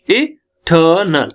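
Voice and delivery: "Eternal" slowly in three parts, r silent; syllable by syllable. Shown as a demonstration. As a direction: stretch the middle of "eternal", i-tuh-nal. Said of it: "तीन syllable का है". ("Eternal" धीरे-धीरे, तोड़कर)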